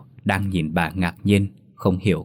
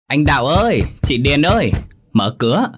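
loud, higher call through the door; 5 semitones higher, 3 dB louder than usual. A narrator's reading. Neighbor Loan bangs on the door calling them, loud. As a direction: call across the yard to wake them.

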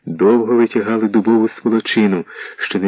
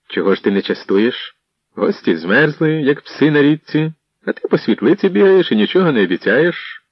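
grumbling complaint, rising higher; displeased; 7 semitones higher than usual. A narrator's reading. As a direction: scold the host, building in pitch. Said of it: "невдоволено озвався".

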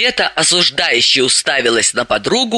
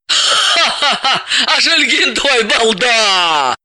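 slow mocking laugh then jeer; 5 semitones higher, much slower than usual. Elizaveta laughs at Dalila's detective, mocking. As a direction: laugh at the clumsy cop. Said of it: "рассмеялась Елизавета".

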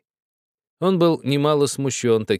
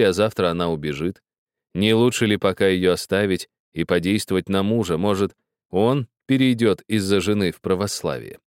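usual speaking voice

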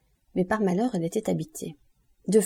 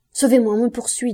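loud, higher urgent plea; supplication; 4 semitones higher, 7 dB louder than usual. A narrator's reading. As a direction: beg to be saved from pursuers. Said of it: "dit Andrea en joignant les mains". (supplication pressante, voix haute et forte)

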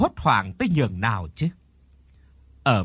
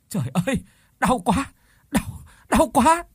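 crying in pain; 9 semitones higher than usual. Acted. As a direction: cry out in labour pain. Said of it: "thét lên một tiếng đau đớn".

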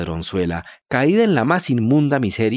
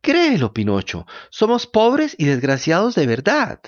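high whining fox voice; 6 semitones higher than usual. A narrator's reading. Fox begs.

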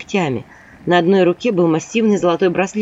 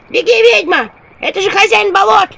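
high, loud squeaky yell; shouting imps; 12 semitones higher, 6 dB louder than usual. A narrator's reading. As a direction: warn her like frantic imps. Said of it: "кричали мне черти". (крик чертят)

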